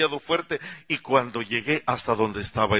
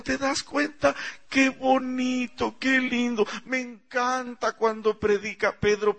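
gushing churchgoer; 8 semitones higher than usual. Acted. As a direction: gush over the preacher. Gushing neighbor praising Pedro. (admiradora entusiasta, aguda y lenta)

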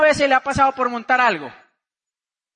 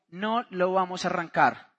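lower, softer retold refusal; low firm refusal; 5 semitones lower, 5 dB quieter than usual. Husband answers his wife's insistence, low and firm. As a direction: dig in quietly on No.

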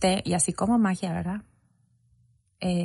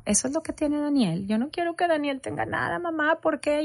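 imitating her young son; 6 semitones higher, 4 dB quieter than usual. Son's worried question retold.

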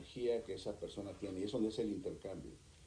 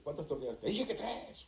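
imitated high-pitched outburst; mock angry yelling; 9 semitones higher than usual. Wife complains that Juan explodes, mimicked high yelling. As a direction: act out a husband blowing up over tortillas. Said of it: "De volada estalla y grita".

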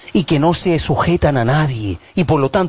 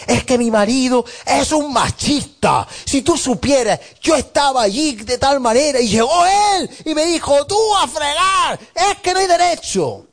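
mock complaining wife; 10 semitones higher than usual. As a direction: play the wife venting about her husband, higher. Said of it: "oyes una versión".

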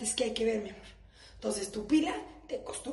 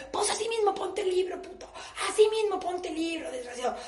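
mock snap; 6 semitones higher than usual. Wife telling off husband.

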